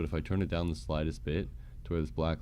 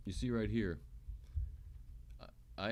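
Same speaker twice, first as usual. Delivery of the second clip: normal talking voice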